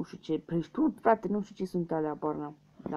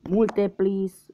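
loud pleading; 3 semitones higher, 8 dB louder than usual. Real, not acted. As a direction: urge the answer to be right. Begging the guess is right. (rugăminte tare și sus)

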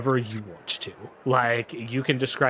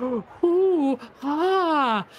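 high, slow amazed ooh; astonished crowd ooh and ah; crowd gasps; 5 dB louder than usual. Acted. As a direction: gasp like a wowed onlooker.

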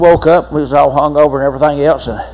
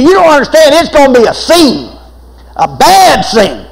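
loud and high, slowing into a shout on the last words; urgent warning; 8 semitones higher, 4 dB louder than usual. Retold or imitated. Jailer warning the prisoner about his mother, loud shout. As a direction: warn him it will get ugly.

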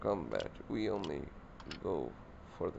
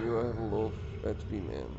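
ordinary talk

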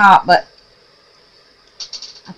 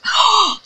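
sharp gasp; 14 semitones higher than usual. Real, not acted. Sees the glitter spray result.

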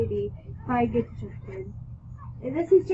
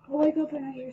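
ordinary delivery

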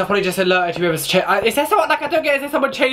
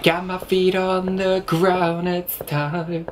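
usual speaking voice

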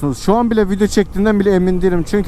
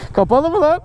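surprised; 9 semitones higher than usual. Real, not acted. Surprised at the intercom being off.

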